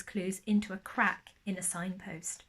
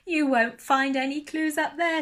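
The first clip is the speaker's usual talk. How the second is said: chuckling; 6 semitones higher, 6 dB louder than usual. Acted. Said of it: "chuckled Percy".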